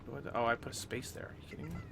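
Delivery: ordinary delivery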